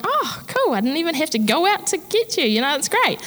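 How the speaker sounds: high, bright and fast mock cheer; mock delight. Greeting visitors with hammed-up, high relief. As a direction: gush over not having to leave the house. Introvert glad not to go out.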